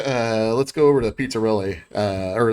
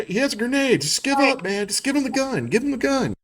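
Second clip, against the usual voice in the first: high-pitched urgent pleading, in character; panicked councilman. Acted. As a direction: act out begging a companion to give up, voice pitched high. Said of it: "You hear him like".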